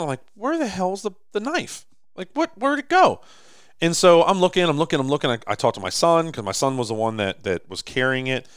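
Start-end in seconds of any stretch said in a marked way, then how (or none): 0.3-3.2 high, baffled, rising panic over the missing knife; retold alarm, voice jumping up as he digs; frantic searching. Dad searching the truck for the lost knife, high baffled alarm.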